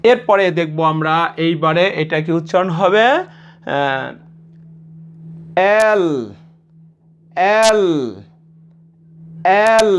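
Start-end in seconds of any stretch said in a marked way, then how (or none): 5.9-9.9 এল with the final l held; slightly drawn out. Shown as a demonstration. Teacher asks learners to drag it slightly, lengthened এল. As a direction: stretch এল a little, each one separate. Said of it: "আমরা একটু টেনে উচ্চারণ করব".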